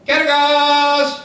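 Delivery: long drawn-out call of a name; stretched calling shout. Acted. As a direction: call him awake from outside. Calling out to wake friend.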